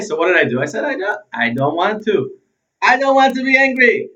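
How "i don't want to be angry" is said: high, loud, whining protest; petulant whine, pitched up and pushed out; whiny tone. Shown as a demonstration. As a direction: whine it like a sulky child. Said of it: "And not in that tone of voice".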